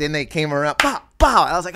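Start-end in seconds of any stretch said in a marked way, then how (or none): 0.8-1.4 high, loud smack noises; imitated blows. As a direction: punch out each hit as a sound effect. Imitates blows landing in story.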